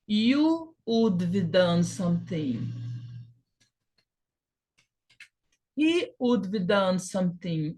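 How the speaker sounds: steady teacher drill, one pronoun at a time; conjugation drill. Shown as a demonstration. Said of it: "Aí você começa a praticar daqui".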